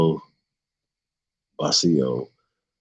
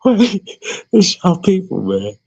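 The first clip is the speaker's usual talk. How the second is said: laughing; 10 semitones higher than usual. Real, not acted. Trying to keep reading, still laughing high. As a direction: fight to talk through laughter.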